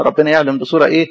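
ordinary talk, nothing marked

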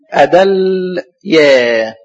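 أدليا hugely drawn out, long L then long yaa; teacher sounding out أدليا as a-dalll, yaaa; spelled out by syllables. Shown as a demonstration. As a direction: stretch أدليا very slowly in two parts. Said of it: "اللام عليها سكون".

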